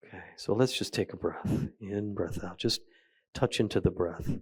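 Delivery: soft, settling meditation guide voice; guided meditation. Acted. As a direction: ease the group into the breath, quietly. Begins a guided breathing practice.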